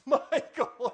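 high, loud alarmed repeat of the name; startled echo. Retold or imitated. Hearing an angel's name in the bathroom, startled and loud. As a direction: react as if the archangel came.

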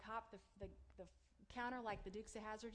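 usual speaking voice

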